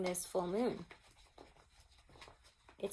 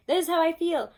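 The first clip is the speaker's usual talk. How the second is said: loud, higher burst of feeling; a loud, high, open confession; bold declaration; 8 semitones higher, 8 dB louder than usual. Retold or imitated. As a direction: blurt out This is how I feel at last. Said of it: "you're finally like".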